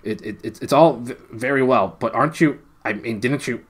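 high, loud startled stammer. A narrator's reading. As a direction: stumble over the words in alarm. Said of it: "he stammered".

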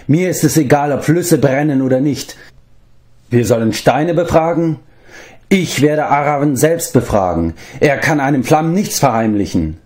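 lightly voiced bravado; Flefdur. A narrator's reading. Bard leaps up to join the quest, high-pitched defiance.

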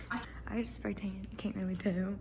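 hushed aside to camera; whispering. Real, not acted. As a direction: whisper it like a secret.

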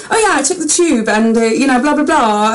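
hammed-up posh British accent; posh London accent. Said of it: "be all posh and be that person".

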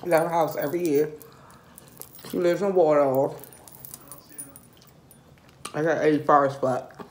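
talking between bites; chewing while talking. Real, not acted. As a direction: mouth full of chicken. Seen 1: Picks at wing, bites meat at 6.4, 10.9, 11.6 while talking.

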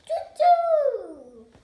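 high, very loud owl hoot. Acted. Owl character hoots goodbye.

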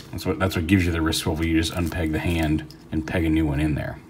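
ordinary delivery

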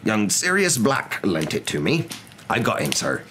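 slow, earnest gentle-giant report; lightly voiced Hagrid. A narrator's reading. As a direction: report respectfully to your superior.

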